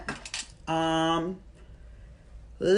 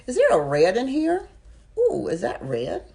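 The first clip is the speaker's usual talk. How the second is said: excited; 8 semitones higher, 4 dB louder than usual. Real, not acted. Spots red lipstick.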